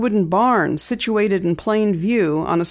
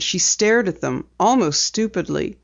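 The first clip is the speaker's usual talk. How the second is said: ordinary delivery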